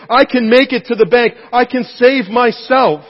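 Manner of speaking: loud, high, straining swimmer; a swimmer telling himself he'll reach the bank; determined cry. Pretend. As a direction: shout your confidence against the current. Swimmer's inner voice, self-reliance.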